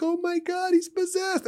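very high-pitched mock alarm; retold panic. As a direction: imitate a terrified onlooker, voice way up. Retells panicked congregation's reaction.

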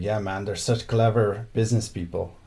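put-on light Mexican accent, casual; imitating a friend's Mexican accent. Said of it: "in his little Mexican accent".

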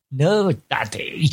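slow, drawn-out posh correction; imitating a condescending little girl; patronising child. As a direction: stretch it out, talking down to dad. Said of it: "She really patronises me".

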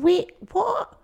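high, startled wait what; a sudden double take; mock-startled wait what, said high; shocked realization. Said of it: "you're going".

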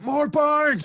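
loud, high-pitched yell from afar; shouting. Retold or imitated. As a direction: holler it out across a lake. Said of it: "He would shout".

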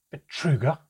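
loud, high, disgusted spit of a name; scornful, incredulous repeat, thrown out hard; spat in disgust. A narrator's reading. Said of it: "spat the name at him in disgust".

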